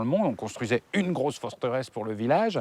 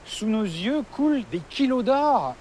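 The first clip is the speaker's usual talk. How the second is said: rising higher in wonder, slowing down; amazed; 7 semitones higher than usual. Real, not acted. Amazed at gold in river.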